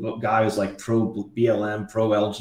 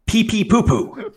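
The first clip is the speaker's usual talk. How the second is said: higher, slow grave anchor reading a silly code word; mock news anchor; 9 semitones higher, slower than usual. Spoofing a news report for his co-host, slow mock gravity. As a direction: deliver nonsense with a straight newsman's gravity.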